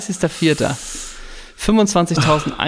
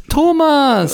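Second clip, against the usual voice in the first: announcer call; 11 semitones higher than usual. Pretend. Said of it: "im Stadionsprechermanier".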